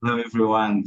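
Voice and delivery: usual speaking voice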